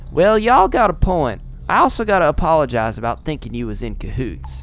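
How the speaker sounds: grudging Southern apology; Southern accent. Acted. Milda apologizing to the heroes in a Southern accent. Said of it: "absorbed in the southern accent".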